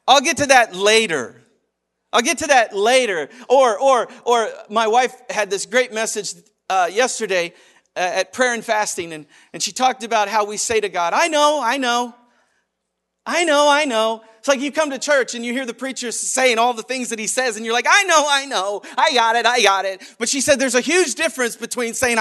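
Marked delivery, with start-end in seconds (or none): 0.1-3.3 tag. Acted. Putting God off. 11.1-14.2 loud, high brush-off; hammed-up know-it-all reply; mock dismissive. Brushing God off. 17.9-20.0 loud, raised, impatient acknowledgment; hammed-up brush-off; mock dismissive. Listener brushing off the preacher.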